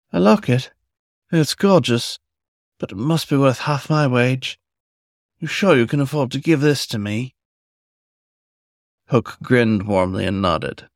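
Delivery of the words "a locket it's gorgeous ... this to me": put-on higher, eager young fox voice; breathless delight at a golden gift; young fox, amazed. A narrator's reading. Fox receiving a locket from Hook, higher amazed voice.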